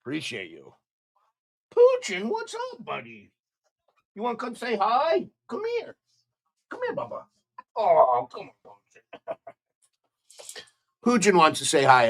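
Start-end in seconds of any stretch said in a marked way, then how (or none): 1.8-9.4 very high sing-song talk to a pet. Real, not acted. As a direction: coax the dog over in a squeaky voice. Talking to his dog.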